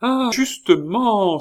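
high, slow, loud cry of delight; delighted exclamation. A narrator's reading. The countess spotting her box, crying out high and loud. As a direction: exclaim it as a happy discovery. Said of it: "s'écria la comtesse".

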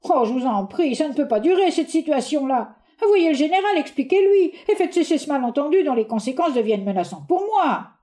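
high, urgent begging; pleading. Acted.

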